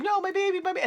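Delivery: high anguished mock wail over the baby; lightly voiced mother crying for her baby; grieving mother. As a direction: cry out like a stricken mother. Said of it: "she's like".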